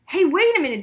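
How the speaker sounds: high, loud startled call. A narrator's reading. Daniel startled by the crying girl, surprised. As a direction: stop her short.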